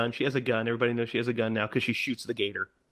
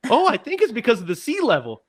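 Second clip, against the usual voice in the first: higher-pitched mimicked objection; imitated passenger; 9 semitones higher, 4 dB louder, faster than usual. Passenger pipes up to the tour guide, mock know-it-all. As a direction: pipe up as the doubting passenger.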